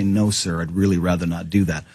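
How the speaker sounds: low, composed refusal, standing your ground; firm, polite refusal. Acted. Guest voicing a citizen refusing the scanner, firm and low. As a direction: say it low and evenly, squaring up politely. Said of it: "be able to square up and say".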